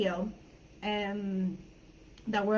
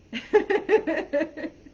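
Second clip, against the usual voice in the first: loud, high burst of laughter; real laugh; 6 semitones higher, 6 dB louder than usual. Real, not acted. Amused at own statement.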